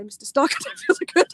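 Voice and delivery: laughing quote. Retold or imitated. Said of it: "I'm going to say".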